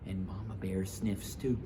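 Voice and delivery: ordinary delivery, nothing marked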